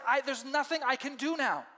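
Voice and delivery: a widow begging them to turn back; pleading Naomi. Acted. Naomi begs her daughters-in-law to go home, pleading. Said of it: "but she's like".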